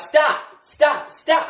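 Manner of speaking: put-on high, loud kid yelling stop; hammed-up kid yelling stop. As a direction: squeal it like a bratty kid. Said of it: "talking about".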